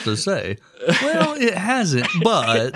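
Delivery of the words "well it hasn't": much higher, slow hedge. Real, not acted. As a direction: stretch it out high. Hesitant joke.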